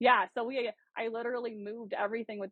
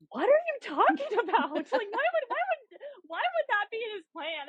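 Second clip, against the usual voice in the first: much higher, disbelieving protest; incredulous; 13 semitones higher, 4 dB louder than usual. Retold or imitated. Wife protesting to her husband, high incredulous disbelief. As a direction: act out the shocked wife. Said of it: "And I'm like".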